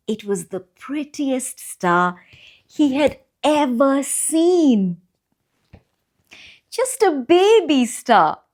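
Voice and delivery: gentle, lively picture-book read-aloud; reading to children. A narrator's reading. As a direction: read it like bedtime story time for little ones.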